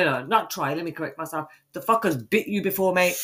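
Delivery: usual speaking voice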